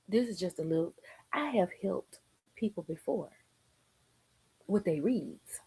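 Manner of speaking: dropped soft, ending in a whisper; soft confiding whisper; hushed aside. Real, not acted. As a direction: lean in and let them in on a secret.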